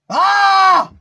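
long high open yell at full power; a sustained, all-out "Ah!" scream; full-throated scream. Shown as a demonstration. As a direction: scream as loud as you can to test the mic. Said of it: "record the intensity of my screams".

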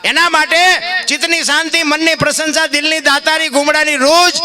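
high, loud fervent listing; preaching at full force. Acted. Preacher lists what comes from the Lord, full-force preaching. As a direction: ring out each gift from above.

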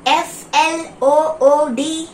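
"F L O O D." spelled out slowly, one letter at a time. Shown as a demonstration. Teacher spells the word for the class, slow letter by letter. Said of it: "Can you read it?".

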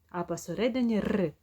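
usual speaking voice

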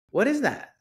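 high, alarmed "What is that?"; startled question. Retold or imitated. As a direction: spot something in the dark and jump up in pitch. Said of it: "And I'm like".

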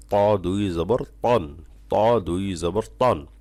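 ত দুই জবর তন said slowly, the ত pressed thick from the tongue root; heavy Arabic ta. Shown as a demonstration. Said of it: "একটু চাপ দিয়ে বলতে হবে".

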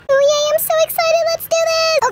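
high, bouncy excitement; excited squeal. Acted. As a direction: squeal it out happily. Girls going on the boat.

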